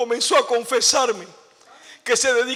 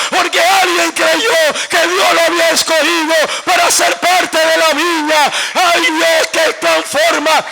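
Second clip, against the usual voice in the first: raised, shouted testimony; impassioned preaching; 4 semitones higher, 6 dB louder than usual. Real, not acted. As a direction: proclaim it forcefully, phrase after phrase. Preaches at full force.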